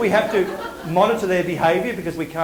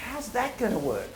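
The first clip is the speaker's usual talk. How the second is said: softer, eager how's that gonna work, lifted on that; curious; 3 semitones higher, 7 dB quieter than usual. Shown as a demonstration. Designers meeting the moon challenge, curious and eager. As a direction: wonder aloud as if the puzzle thrills you. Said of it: "You see the difference?".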